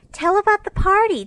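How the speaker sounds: put-on high, loud childlike cry, falling at the end; two little sisters begging eagerly; eager little girls. A narrator's reading. Sleepy little girls beg their sister for party news, eager high cry. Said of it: "two sleepy but eager voices cried out".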